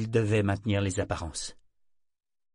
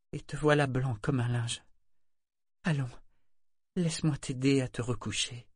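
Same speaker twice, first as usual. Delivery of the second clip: higher, teary, contrite voice; tearful apology; 4 semitones higher than usual. A narrator's reading. Etta to wounded Kenneth, teary and contrite. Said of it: "les larmes aux yeux".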